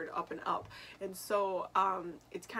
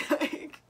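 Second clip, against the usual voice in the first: high, loud giggle breaking out; burst of laughter; 5 dB louder than usual. Real, not acted. Laughs at own question.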